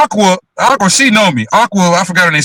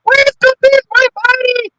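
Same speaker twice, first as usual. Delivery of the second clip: much higher, slow gushing woman's voice; imitating Flo; slower than usual. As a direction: gush sweetly like an excited friend. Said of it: "she's like".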